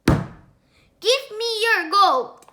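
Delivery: loud, slow, high robber shout; big villain yell from the storybook. Acted. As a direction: bellow the demand like a thug. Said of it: "he shouted".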